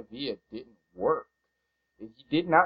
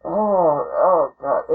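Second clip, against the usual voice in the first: anguished groan; 6 semitones higher, 6 dB louder, much slower than usual. Real, not acted. Groaning at bad news.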